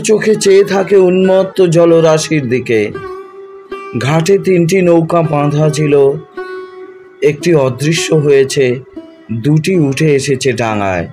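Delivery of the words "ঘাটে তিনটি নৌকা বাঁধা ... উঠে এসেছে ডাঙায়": ordinary delivery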